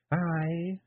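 high Scottish Feegle woman coaxing; wife coaxing her husband into writing. A narrator's reading. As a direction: push your husband gently, high and Scots. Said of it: "said Jeanie".

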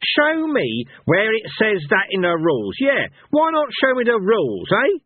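high-pitched, raging challenge; dancing with rage. A narrator's reading. Cohen demands the rules from Fate, enraged. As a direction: demand it furiously, pitch right up. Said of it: "dancing with rage".

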